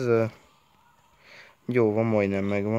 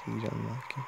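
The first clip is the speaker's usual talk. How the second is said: ordinary talk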